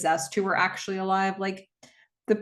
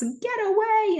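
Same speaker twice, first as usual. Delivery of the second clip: mock shout, pitched far higher; a quick pitched-up imitation of a scream to go away; retold shout. Louise driving off the demonic voice, mock high yell. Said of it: "then she shouts".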